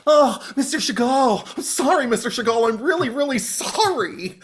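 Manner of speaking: high apologetic mock voice without the accent; hammed-up grovelling apology; dropped accent. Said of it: "drops the French thing".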